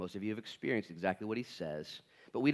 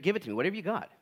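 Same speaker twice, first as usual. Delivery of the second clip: high, loud, rushed bravado; mock-brave patient at the doctor's; imitating an eager patient; 9 semitones higher, 7 dB louder, faster than usual. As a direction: play the patient daring the doctor. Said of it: "You're like".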